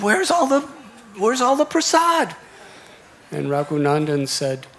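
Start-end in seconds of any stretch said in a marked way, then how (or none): 0.0-2.4 alarmed mother. Acted. Mother finds the plate empty.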